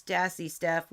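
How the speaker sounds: normal talking voice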